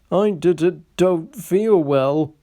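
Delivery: stammered, slow, faking illness; quavering. A narrator's reading. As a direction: put on a feeble stutter. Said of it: "quavered Sir Handel".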